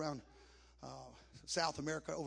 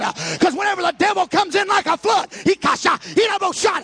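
high, loud preacher shouting to the church; full-force preaching; 11 semitones higher, 23 dB louder than usual. Acted. Preacher shouting to the congregation about the Holy Ghost, full force.

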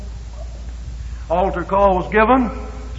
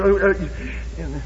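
ordinary talk

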